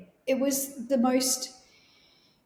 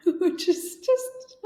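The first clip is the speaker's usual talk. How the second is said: laughing; 7 semitones higher, much slower than usual. Real, not acted. Laughing at grandfather's typical remark.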